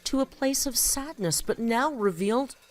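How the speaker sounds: dramatic spoken-word poem; poem recitation. Acted. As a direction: give each line weight, like spoken word. Reads her own poem aloud.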